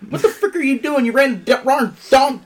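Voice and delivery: high, loud whiny scolding; mock Rivers scolding. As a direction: scold the receiver in a shrill parody. Imitating Rivers scolding receiver.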